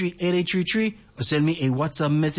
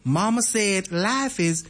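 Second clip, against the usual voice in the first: slow, higher-pitched Alabama drawl; Alabama accent; 5 semitones higher, slower than usual. Acted. Radio host repeats the movie line for listeners, slow Alabama accent. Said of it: "I have to put in an accent".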